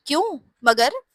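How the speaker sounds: mild jolt of surprise; shocked. A narrator's reading. Father hearing his son was slapped, mildly shocked. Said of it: "झटका लगा था".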